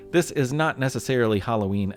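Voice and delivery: usual speaking voice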